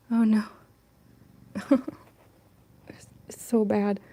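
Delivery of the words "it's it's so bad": slow unvoiced whisper. Real, not acted. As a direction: whisper slowly in despair.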